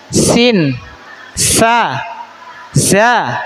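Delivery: sin, sa, za spoken as separate clear Arabic letters; letter demo. Shown as a demonstration. Teacher shows letter sounds to class, careful articulation. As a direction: lay out each letter slowly for pupils to copy. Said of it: "lidahnya di mana atau makhrajnya di mana".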